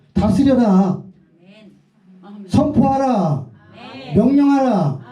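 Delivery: slow, exalted calls to rule and declare; ringing command. Acted. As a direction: cry each command to the crowd. Imperatives proclaimed.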